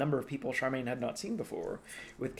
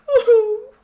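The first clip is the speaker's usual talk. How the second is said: loud high startled ooh; shrill squeal; 18 dB louder than usual. Acted. Nursemaid startled amid the commotion, loud high squeal. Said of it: "The fat nursemaid went".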